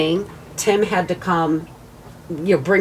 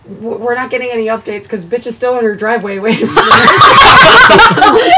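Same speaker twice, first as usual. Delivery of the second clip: laughing; 3 semitones higher, 11 dB louder than usual. Retold or imitated. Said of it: "and they're like".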